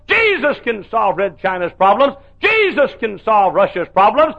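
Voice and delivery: rising preacher's refrain on Jesus; preaching cadence. Acted. Preacher proclaiming Jesus solves all problems, rising. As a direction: hammer each Jesus line, lifting the tune.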